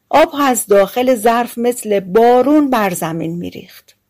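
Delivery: usual speaking voice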